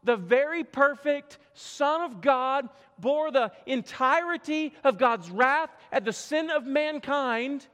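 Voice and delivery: high, loud, slow preaching on the cross; forceful preaching. Acted. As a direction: thunder it from the pulpit, slowly.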